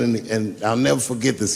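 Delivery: normal talking voice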